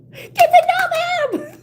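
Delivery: very high, drawn-out squealed plea; mock wail. Reader jokingly advising herself, mock wail. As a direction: squeal it loud as a hammed-up cry.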